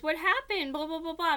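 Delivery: lightly acted startled question; mock concerned questioning. Stepdad asks, acted out.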